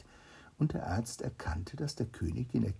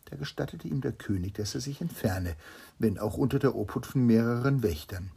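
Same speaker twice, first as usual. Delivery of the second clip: ordinary delivery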